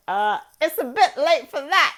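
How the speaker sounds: high, loud, laughing protest; laughing retort. Retold or imitated. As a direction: blurt the punchline through laughter. Joking retort to the warning.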